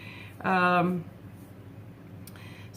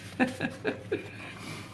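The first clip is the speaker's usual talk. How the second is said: soft breathy laugh; 6 semitones higher, 9 dB quieter than usual. Real, not acted. Laughs after reading the prayer.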